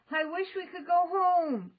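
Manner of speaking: loud high shout. A narrator's reading. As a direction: shout the wish. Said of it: "he shouted".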